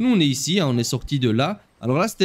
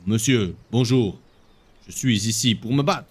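a lightly lowered heroic voice, stating his purpose; voicing the hero; 8 semitones lower than usual. Hero greeting the master and asking to fight, low and grave.

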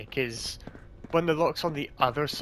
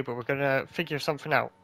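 ordinary talk, nothing marked

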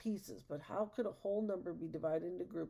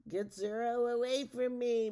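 loud, higher-pitched complaint; yelled; 5 semitones higher, 7 dB louder than usual. A narrator's reading. Witch swings the staff at the hopping dog, annoyed yell. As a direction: raise the voice and push it away. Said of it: "Matilda yelled".

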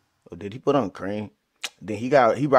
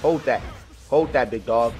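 ordinary talk, nothing marked

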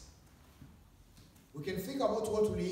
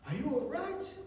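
worried concern; 8 semitones higher than usual. Retold or imitated. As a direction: fuss over him like he's hurting. Congregation worrying over crying Tyrone.

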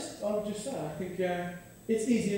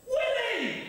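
much higher mock toddler yell through laughter; 14 semitones higher than usual. Toddler shouts a new word across the park, high mock yell. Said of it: "the top of her voice".

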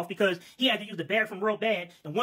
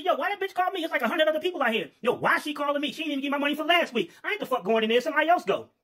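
higher, indignant complaining; annoyed refusal; 6 semitones higher than usual. Acted. Worker refusing to rescue his stingy boss, higher indignant grumble. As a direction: grumble like you won't lift a finger.

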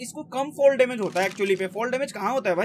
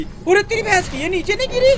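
high, louder exclamation; surprised outburst; 9 semitones higher, 4 dB louder than usual. Real, not acted. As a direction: react in shock. Reacting to enemy not falling. (चौंककर ऊँची आवाज़ में)

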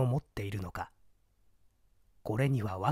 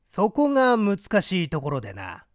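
scheming man; 6 semitones higher, 6 dB louder than usual. Acted. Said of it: "頬骨の出た男が言うのだ".

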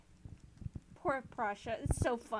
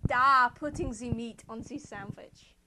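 put-on loud duh in a thick German accent; hammed-up German accent, projected; mock German accent; 10 dB louder than usual. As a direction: answer loudly with a fake German accent. Prussia's reply in accent.

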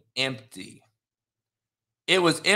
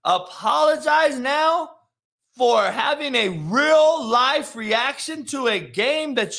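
raised, higher-pitched incredulous questioning; indignant, rising rant; outraged disbelief; 6 semitones higher than usual. Real, not acted. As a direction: stretch out each question in exasperated disbelief.

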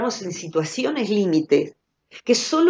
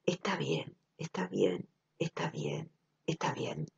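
soft, much quieter, fading into a whisper; softly fading; 11 semitones lower, 13 dB quieter than usual. Retold or imitated. Reading the song lyric aloud, softly fading. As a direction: comfort yourself, letting it die away. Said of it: "Lo leo de corrido entonces".

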